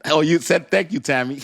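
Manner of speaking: high, loud laughing delivery. Real, not acted. Streamer thanking a teasing viewer, laughing. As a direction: crack up while talking.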